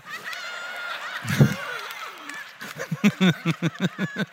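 real high-pitched laughing fit; high shrieking laugh. Real, not acted. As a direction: cackle at the joke. Laughing at his own punchline.

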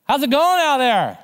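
high, loud shout out to a boat; calling from shore. Acted. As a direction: holler it over the water. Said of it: "from the shore".

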